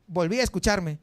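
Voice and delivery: ordinary delivery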